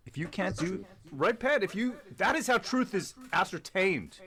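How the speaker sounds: high, mocking imitation of the opponent; mock debater voice. Mocking the debater to the panel in a high sneering voice. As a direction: parrot him in a high sneering voice.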